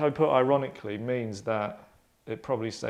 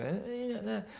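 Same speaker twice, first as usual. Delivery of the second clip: much higher, softer scolding squeak; mock grammar checker; 10 semitones higher, 7 dB quieter than usual. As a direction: nag like a fussy spellcheck. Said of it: "little blue dots".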